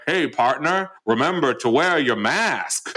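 Southern cowboy voice. Retold or imitated. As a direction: play a friendly Southern cowboy from a health ad. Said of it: "they do it in kind of this Southern voice".

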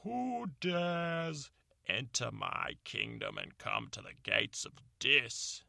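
booming, slow monster challenge; slow and looming, like a beast; dragon voice. Acted. Medusa challenges at gates.

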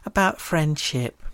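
slow, drawn-out sarcastic tone. Pretend. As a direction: drag it out with heavy sarcasm. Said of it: "the most sarcastic voice I've ever used".

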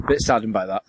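ordinary talk

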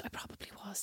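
barely audible whispered admission; sheepish aside. Retold or imitated. Admitting the sleep-talk, sheepish aside. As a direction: mumble it under your breath.

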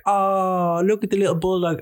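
high cooing voice, far from her own; mocking cooing passerby. Passerby cooing at a puppy in a high put-on voice. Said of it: "they'd be like".

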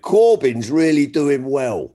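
slow, beaming good news; grinning signalman. Retold or imitated. Rail worker shouting down the election news, slow and beaming. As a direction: let the grin come through the words. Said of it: "with a great big grin and went".

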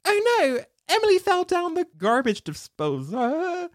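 a high, hammed-up posh British voice laughing through it, with "garbage disposal" coming out American; mock a dubbing actor whose accent keeps slipping. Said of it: "They'd be like".

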